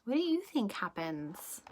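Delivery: usual speaking voice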